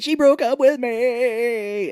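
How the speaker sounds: high, loud, drawn-out whine; exaggerated lovesick howl; mock heartbroken wail. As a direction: wail it out, hammed-up and slow. Acted wail of heartbroken character.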